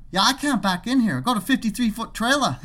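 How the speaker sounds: high-pitched imitated Aussie complaint; Australian accent. Trucker returning to complain, mock Australian accent. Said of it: "making fun of his accent".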